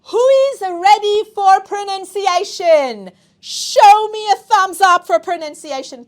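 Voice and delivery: cheering the class on to pronunciation; rallying the class. Real, not acted. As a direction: pump the students up. Teacher rallies class for pronunciation.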